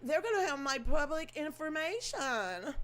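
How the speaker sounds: slow, high, whiny mock panic; mocking worried voter. Vlogger mocking voters fretting over their privacy. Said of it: "So if you sitting here like".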